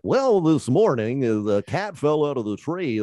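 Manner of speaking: newscaster voice. Retold or imitated. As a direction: parody a stuffy TV news anchor. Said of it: "not sounding like a newscaster".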